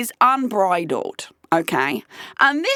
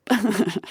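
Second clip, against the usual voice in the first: stifled laugh. Real, not acted. Can't keep a straight face.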